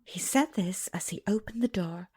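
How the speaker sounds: usual speaking voice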